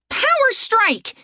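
high, slow battle cry; shouted attack call. A narrator's reading. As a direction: call the move high and drawn out.

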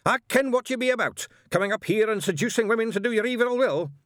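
loud, high-pitched accusation; outraged shout. Acted. Witchfinder accuses angel of seducing women, shouting. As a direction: rant at him in righteous fury. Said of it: "he shouted".

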